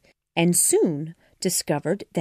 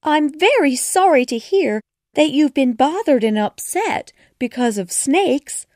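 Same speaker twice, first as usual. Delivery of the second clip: sympathetic; 8 semitones higher, 5 dB louder than usual. A narrator's reading. Alice sympathising loudly.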